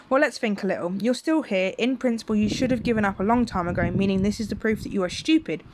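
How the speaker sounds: ordinary delivery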